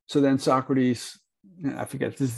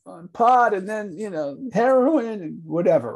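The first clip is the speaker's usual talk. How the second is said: twitchy slurred sniffing addict; 6 semitones higher than usual. Acted. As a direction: play a strung-out junkie testifying. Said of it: "Johnny gets up there scratching like a junkie".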